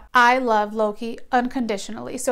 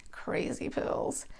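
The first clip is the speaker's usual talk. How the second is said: whispered aside; 13 dB quieter, much slower than usual. Real, not acted. Aside about medication.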